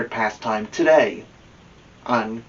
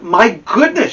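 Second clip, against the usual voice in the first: higher, louder "My goodness"; startled by the clock; surprised; 9 semitones higher, 6 dB louder than usual. Acted. Realizing she's late, Amelia Bedelia exclaims higher and louder.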